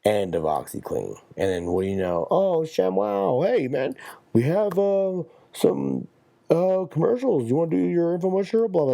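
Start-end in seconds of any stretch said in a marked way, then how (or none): 2.3-8.9 much higher, sugary eager voice; fawning TV exec. Acted. TV execs court the ShamWow guy, high sugary fawning voice. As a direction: suck up to the new star.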